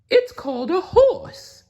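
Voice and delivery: high, loud cautious cow. Acted. The cow warns Blinky about the humans, high and loud. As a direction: warn him gently in a high cow voice. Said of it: "said the cow".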